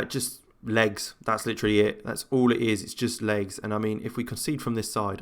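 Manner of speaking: usual speaking voice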